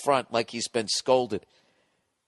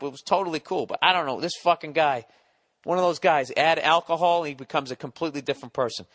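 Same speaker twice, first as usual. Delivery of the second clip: ordinary talk, nothing marked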